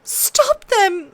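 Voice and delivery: put-on girl pleading with her father. A narrator's reading. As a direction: plead for help. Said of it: "Sansa pleaded".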